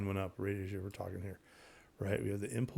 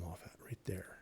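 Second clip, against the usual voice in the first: whisper; 4 semitones lower, 13 dB quieter than usual. Acted. Pointing to the chart bottom.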